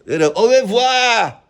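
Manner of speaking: high, slow, put-on French accent; mock French kid. British vlogger mocks French schoolkids, hammy French accent. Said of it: "what they, they go".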